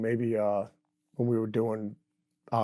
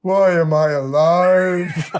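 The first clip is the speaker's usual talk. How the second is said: louder, very slow, stretched-out wail; hammed-up existential moan, drawn way out; 3 semitones higher, 8 dB louder, much slower than usual. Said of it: "He goes".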